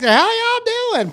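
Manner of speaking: high-pitched put-on Southern twang; mock Southern accent. Host mocking candidate's newfound Southernness, mock Southern accent. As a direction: exaggerate a fake Southern greeting. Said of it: "Kamala's a Southerner now".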